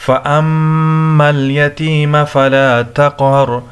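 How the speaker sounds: recited with a long nasal hum on the m of فَأَمَّا; ghunnah. Shown as a demonstration. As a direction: hold the doubled m in فَأَمَّا through the nose. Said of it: "اس پہ غنہ ہوگا".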